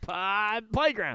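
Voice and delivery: the word playground finally pops out; relieved exclamation. Retold or imitated. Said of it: "I was like".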